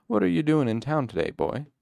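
put-on a kindly country farmer teasing the boy; old farmer. Old farmer voiced in story.

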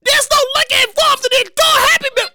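real indignant yelling; incredulous shout. Real, not acted. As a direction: protest it like you've been cheated. Incredulous outburst about no liquor.

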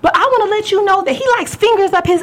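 high-pitched, mouthy retort; defiant ex, acted. Ex exposing him as she leaves, high defiant mimicry. Acting out ex's angry parting shot.

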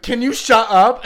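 loud, slow irritated shout at a crying sister; mock annoyed yell. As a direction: yell it slowly through the door. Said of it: "my sister opened the door and said".

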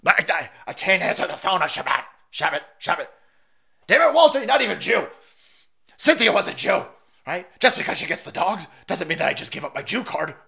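a lightly voiced Lebowski character, fussy and huffy; Big Lebowski impression. Quoting Big Lebowski to viewers, a lightly voiced movie impression. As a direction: do a loose, half-hearted movie-character bit. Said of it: "that guy in The Big Lebowski told me so".

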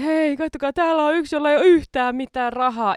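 mocking robber shout. Robber mocking the empty wallet to the crowd, jeering shout. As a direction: shout it out to everyone, mocking and laughing. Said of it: "se huutaa kaikille ja nauraa".

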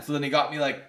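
usual speaking voice

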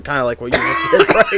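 usual speaking voice

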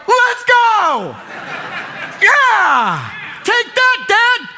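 high, loud whooping cheer with a laugh; gleeful cheer. Retold or imitated. Son cheering his dad after the confrontation, elated shout. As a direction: cheer on the dad like a thrilled kid. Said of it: "I was like".

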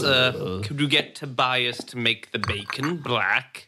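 lordly, deliberate character voice; fussy breakfast orderer. Acted. Master asks butler Terence for breakfast, lordly and deliberate. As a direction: order breakfast with grand emphasis.

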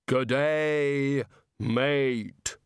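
drawn-out, ponderous greeting from the Reaper; Death. Acted. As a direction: intone each word slowly as Death trying matey slang. Death greets in local style.

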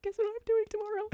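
high, giddy sing-out; mock excited. Retelling a text to friends, high mock excitement. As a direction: squeal it like big news, playfully.

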